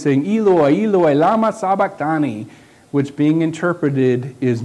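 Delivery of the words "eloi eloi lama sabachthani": a touch of a raised cry; lightly voiced anguished cry; loud cry. A narrator's reading. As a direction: call out to God from the cross. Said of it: "Jesus cried with a loud voice".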